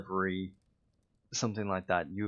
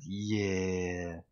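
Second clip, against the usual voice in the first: impression of Mac. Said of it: "that was a terrible impression".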